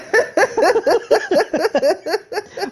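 a high, breathless shriek of laughter. Real, not acted. Laughing at her own food confession, a high shriek. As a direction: crack up into a high squeal.

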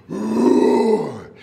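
big loud pretend roar; lion roar. Playing lion with the children, loud roar. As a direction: let out a long growling roar. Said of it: "give a roar".

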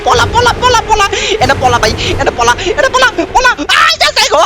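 high shouts of run, run; panicked yelling. Real, not acted. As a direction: yell to flee in a panic. Fleeing the attacking wife. (ভয়ে চিৎকার করে পালানো)

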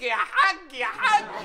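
high teasing sing-song "يا حاج"; mocking hajj call. Ramzi teasing Dola about the hajj, high mocking call. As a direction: needle him with a high "يا حاج".

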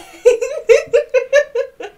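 loud, high burst of laughter; high-pitched giggle. Real, not acted. Player amused at posing for selfies, high loud giggle.

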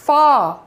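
"far" as a long "faa", no R; open-mouthed long AA. Shown as a demonstration. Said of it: "बड़ा मुंह खोला".

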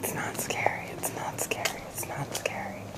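a hushed mantra against fear; whispered reassurance. Real, not acted. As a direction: whisper it over to calm yourself. Calming herself, whispering.

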